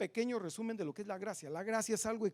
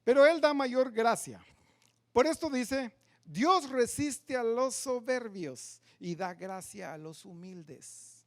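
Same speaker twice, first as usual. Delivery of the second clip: scripture reading; 4 semitones higher, 6 dB louder, slower than usual. Shown as a demonstration. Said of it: "Miren, dice".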